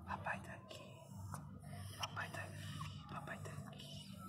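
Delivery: whisper. Real, not acted. Whispering comfort to dog.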